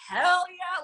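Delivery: high, loud, whooping Hell yeah; husband's hell yeah. Retold or imitated. Husband cheering his wife's new business, high and loud.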